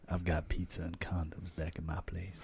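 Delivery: low, soft seductive murmur; sexy voice. Shown as a demonstration. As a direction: murmur it like an invitation. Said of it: "talk like this".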